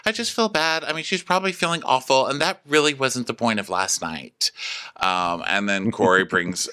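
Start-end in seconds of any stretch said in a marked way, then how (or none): 0.1-4.5 pitched-up, fast, fretful mock voice; mock Rachel. Mimicking Rachel telling Sierra she feels bad. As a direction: play her as guilty and breathless. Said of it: "Rachel's like".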